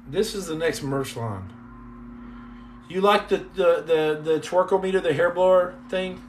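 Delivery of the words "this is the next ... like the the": ordinary delivery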